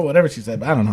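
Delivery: ordinary talk, nothing marked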